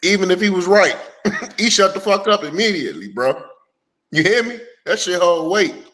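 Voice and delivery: ordinary talk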